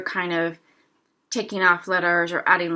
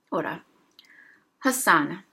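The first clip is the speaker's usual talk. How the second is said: ordinary talk, nothing marked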